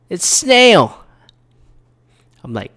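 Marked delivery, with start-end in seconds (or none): 0.1-1.0 very high, louder imitation of a yelling woman; mock yelling. Lady announcing her word for the weather, mock yelling. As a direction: proclaim it as a high-pitched mock yell. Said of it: "She started yelling".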